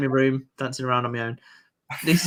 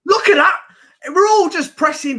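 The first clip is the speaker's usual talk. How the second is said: excited; 12 semitones higher, 4 dB louder than usual. Real, not acted. Team pressing, fan cheering.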